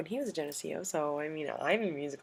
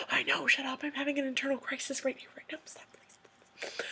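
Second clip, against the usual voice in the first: high squeak fading into a whisper; retold hushed panic, dying away; panicked whisper; 8 semitones higher, 4 dB quieter than usual. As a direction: whisper a frantic plea to stop. Whispered reply, internal crisis.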